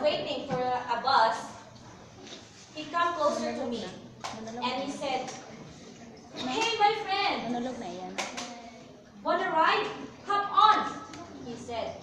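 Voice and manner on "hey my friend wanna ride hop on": calling out. Acted. Friend pulls up offering a ride to someone at a bus stop, calling out.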